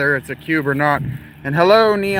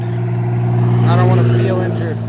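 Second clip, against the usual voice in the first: whiny whisper; 5 semitones lower, 4 dB louder, slower than usual. Real, not acted. Complains about his limping gait.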